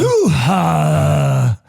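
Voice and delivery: quick hammed-up celebrity catchphrase; Al Pacino impression. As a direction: bark it out like the famous film catchphrase. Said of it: "accent of Al Pacino".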